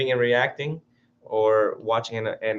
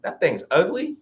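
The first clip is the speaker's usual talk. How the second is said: higher-pitched animal's idle verdict; unbothered animal; 8 semitones higher than usual. Retold or imitated. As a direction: lightly voice an animal sizing someone up. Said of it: "and they're like".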